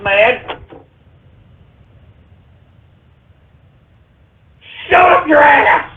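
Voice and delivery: high, indignant yelling; angry shouting. Acted. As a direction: blow up at the pranksters. Angry rant at prank callers.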